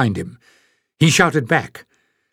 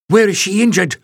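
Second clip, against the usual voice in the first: sharp, urgent, higher demand; 6 semitones higher than usual. Acted. Pen demanding to know where Jasmine is hurt, urgent and raised. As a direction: demand it fast and firm. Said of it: "she demanded".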